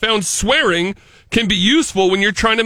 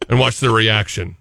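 ordinary talk, nothing marked

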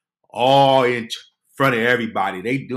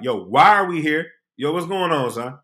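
louder, higher, alarmed asking; alarmed question; 5 semitones higher, 5 dB louder than usual. Retold or imitated. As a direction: urgently ask why you're here.

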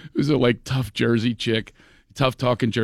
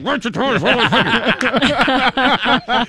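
higher, slow, laughing through a scold; mock scolding, laughing; 10 semitones higher, 4 dB louder, slower than usual. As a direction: hiss it out cracking up. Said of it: "She said".

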